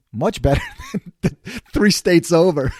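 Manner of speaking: breaking up into laughter; laughing. Real, not acted. Teller amused by his own flattery, helpless laughing. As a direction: say it while cracking up.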